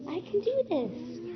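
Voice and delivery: high, slow and encouraging; teacher modeling brave self-talk. Shown as a demonstration. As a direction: say it like you believe it. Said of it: "what could you say?".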